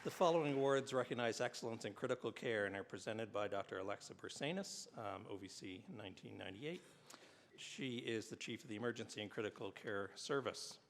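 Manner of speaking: formal ceremony announcer; award announcer. Acted. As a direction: read it like an awards presenter. Reads award citations at ceremony.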